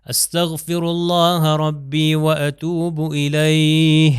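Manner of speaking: استغفر with a clear غ, الیہ drawn out very slowly ending on ہ; slow Arabic recitation. Shown as a demonstration. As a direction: chant it slowly, land on the ہ of الیہ. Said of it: "ہی کی آواز آئی".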